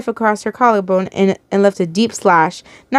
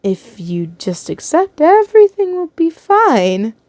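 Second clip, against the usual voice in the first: slow, hesitant start rising high; nervous begging; 6 semitones higher, slower than usual. A narrator's reading. Timid captor begging captive to accept, nervous. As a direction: plead haltingly, pitch climbing to the end. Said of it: "he stuttered".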